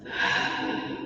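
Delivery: slow audible breath out through the mouth; long exhale. Real, not acted. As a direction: let the air go with a sigh. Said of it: "out of the mouth".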